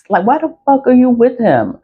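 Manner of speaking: high-pitched disbelieving question; imitating baffled onlookers; incredulous challenge. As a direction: question a friend's choice in disbelief. Others challenge her about her partner.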